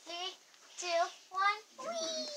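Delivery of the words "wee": high gleeful squeal; excited whee. Real, not acted. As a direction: squeal with joy on the way down. Sliding down again, cheering.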